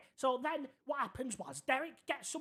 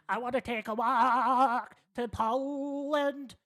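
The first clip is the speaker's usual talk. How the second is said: put-on slow, louder old man insisting; 3 semitones higher, 7 dB louder, slower than usual. Old man Derek insisting on Poland, slow and loud. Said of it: "But he said".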